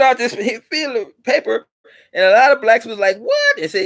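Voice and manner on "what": a sharp, very high "What?"; surprised. Retold or imitated. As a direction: jump up in disbelief. Crowd hears of free ship.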